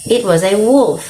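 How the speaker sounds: usual speaking voice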